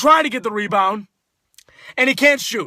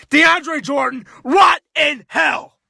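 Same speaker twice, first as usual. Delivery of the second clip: anguished slow shout; 2 semitones higher, much slower than usual. Real, not acted. Fan's final curse at player.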